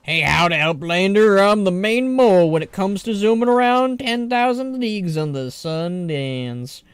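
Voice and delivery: hearty southern game character; southern accent. Acted. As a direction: brag in a broad southern twang. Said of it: "they just sound better with the southern accent".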